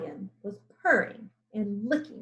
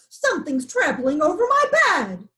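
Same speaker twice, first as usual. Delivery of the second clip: alarmed; 6 semitones higher, 8 dB louder than usual. A narrator's reading. Alarmed lion.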